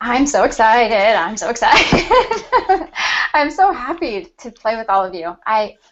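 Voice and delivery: ordinary talk, nothing marked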